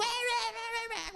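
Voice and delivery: squealed high, dragged-out mimicry of wild kids; frantic mock chaos. Pastor mimicking the screaming choir kids, shrill and slow. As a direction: squeal like a mob of overexcited kids.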